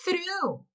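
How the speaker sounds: teacher shows the difference on "Through"; model word. Shown as a demonstration. Teacher contrasts "Through" with the previous word. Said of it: "Olha só a diferença".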